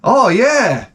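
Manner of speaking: high mock Spanish-guy exclamation; imitating a Spanish guy admiring chrome trim; Spanish guy impression. Comic imitating Spanish guys admiring their chrome. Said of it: "Spanish guys".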